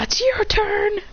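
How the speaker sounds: high, sing-out call; acted battlefield call; calling a teammate. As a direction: pitch it high to hand over.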